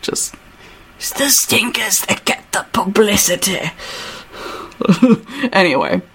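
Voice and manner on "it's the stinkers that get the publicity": softer British character accent; put-on British accent, softened; Hutch accent. Shown as a demonstration. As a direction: quietly try the English accent. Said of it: "That's the accent I want for Hutch".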